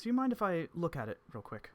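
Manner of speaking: put-on courteous old sage asking to see; Evans voice.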